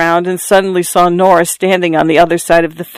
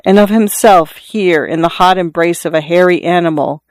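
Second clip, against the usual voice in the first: ordinary delivery, nothing marked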